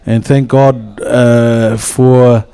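rising, earnest prayer voice. Acted. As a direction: lift the room into prayer. Leads a prayer.

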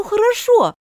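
quick eager hasty hurry-along okay; hasty appeasing. Acted. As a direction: push it eagerly to move on. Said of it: "торопясь перевести разговор".

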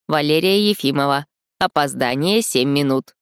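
even, mechanical robot announcement; measured android voice. Acted. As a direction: state it like a calm machine. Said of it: "произнёс размеренный голос андроида".

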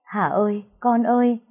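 gọi con, giọng cao dần; người mẹ thảng thốt gọi con; mẹ kêu khóc, diễn nhẹ. A narrator's reading. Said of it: "tiếng kêu khóc dường như của mẹ". (tiếng mẹ kêu con, chỉ thoáng vẻ khóc)

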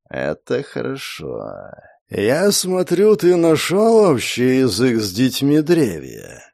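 slow, drawn-out serpent voice; drawling serpent. Acted. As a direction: stretch every word lazily. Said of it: "растягивая слова".

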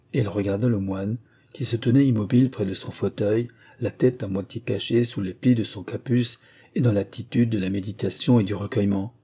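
ordinary delivery, nothing marked

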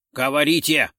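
loud, demanding order; shouted command. A narrator's reading. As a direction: call urgently into the radio. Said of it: "крикнул комиссар". (требовательный окрик)

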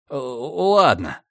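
hesitant, slow stammer. A narrator's reading. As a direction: stumble over the words. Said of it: "немного заикаясь". (запинаясь)

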